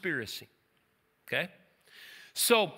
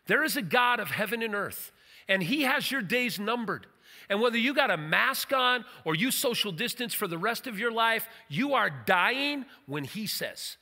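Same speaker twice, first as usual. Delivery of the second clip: forceful preaching; 4 semitones higher than usual. Acted. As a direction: drive it home like a preacher.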